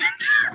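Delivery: short, very high shrieking laugh. Real, not acted. Friend laughs about the ruined ship, high shrieking laugh. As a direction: let out a sharp squealing laugh. Laugh after "yet I couldn't.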